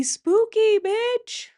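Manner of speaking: squeaky high, sing-song; high mock-spooky.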